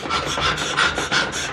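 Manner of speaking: breathless gasp of relief; big relieved gasp. Retold or imitated. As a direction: let out a huge gasp once outside. Outside the door, relief.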